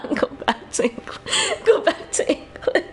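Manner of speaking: words broken up by real laughter; laughing while talking. Real, not acted. Joking about a friend fleeing North America, laughing. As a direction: struggle to get it out through giggles. Cracking up over friend quitting visit.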